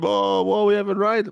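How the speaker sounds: imitating complaining drivers with a high, loud whine; whiny driver. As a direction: mimic a moaning driver. Mocking drivers complaining in feedback room.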